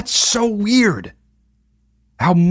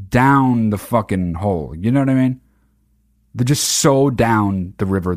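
ordinary talk, nothing marked

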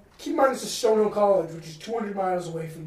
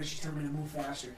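ordinary talk, nothing marked

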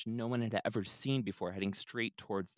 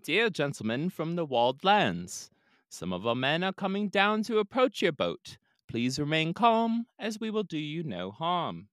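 put-on a higher, louder, polite slight British accent; 8 semitones higher, 8 dB louder than usual. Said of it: "speaking in a slight British accent".

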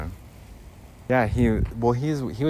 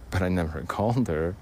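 ordinary talk

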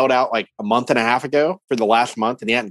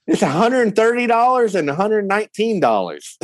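high, lightly imitated Southern clerk; southern lady; 8 semitones higher than usual. Southern lady answering the worried caller, lightly imitated high voice. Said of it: "this real southern lady picks up".

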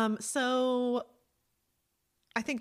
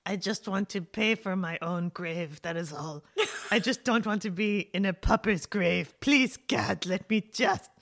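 slow mock plea, ends louder; pleading old cat; 3 semitones lower, 7 dB louder than usual. Voicing the old cat begging for her own grave, hammed-up plea. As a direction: beg God like a tired old lady. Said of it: "Lady's like".

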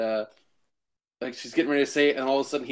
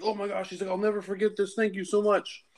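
grateful stranger; 7 semitones higher than usual. Retold or imitated.

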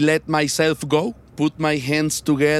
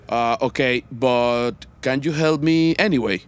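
ordinary talk

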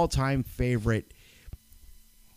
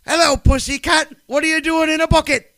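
teasing flirty voice; 14 semitones higher, 9 dB louder than usual. Retold or imitated. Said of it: "It says".

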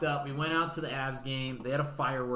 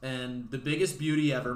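usual speaking voice

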